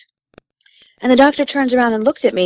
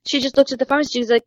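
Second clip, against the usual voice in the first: ordinary talk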